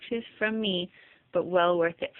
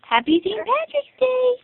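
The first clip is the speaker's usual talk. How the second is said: very high, louder sing-song; sing-song baby talk; 6 dB louder than usual. Shown as a demonstration. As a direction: coax the baby to say it sweetly.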